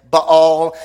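"Baal" said as "ba-ALL", with an open aw; alternative pronunciation. Shown as a demonstration. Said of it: "different ways of saying it".

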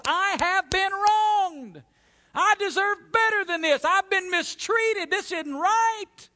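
loud, high-pitched indignant yell; angry, wronged outburst. Acted. Preacher acting out the flesh rising up in anger, shouting indignantly. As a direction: rise up and protest at full volume. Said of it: "to get angry, to be mad".

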